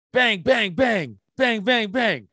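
punchy high "bang, bang" gun noises; imitated gunshots. Host mimes gunfire as the way out, with punchy bangs. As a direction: spit them out like shots firing.

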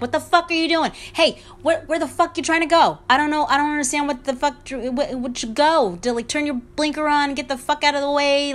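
angry Italian New Yorker yelling at other drivers, a touch hammed up; Joe Pesci road rage. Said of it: "like, my accent comes out".

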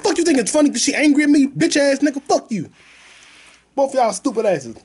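high-pitched indignant cursing; angry retort. Retold or imitated. Passenger cursing out the guy laughing at him, angry.

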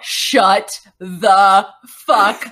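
drawn-out, slow shut the fuck up; stunned disbelief. Real, not acted. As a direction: let the shock stretch every word. Friend hearing the coincidence.

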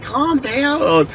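high imitated calming plea; pleading dad. Dad to his crying son, high imitated plea. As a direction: act out a dad calming a wailer.

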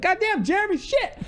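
very high, squealed swearing; mock panic. Student pilot to instructor as plane banks, mock panic.

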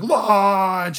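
loud, high, drawn-out yell; urgent shouted command. Retold or imitated. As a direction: holler it like fleeing crew. Crew yelling as ship flees.

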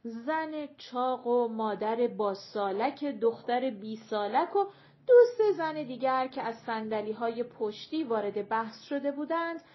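usual speaking voice

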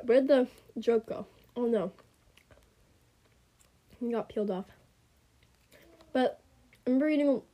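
usual speaking voice

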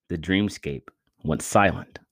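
plain reading voice

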